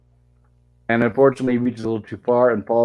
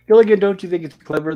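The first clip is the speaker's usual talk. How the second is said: lightly voiced Skipper, louder and higher; mock Skipper; 7 semitones higher, 6 dB louder than usual. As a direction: boom it out like the captain. Skipper voiced in retold episode.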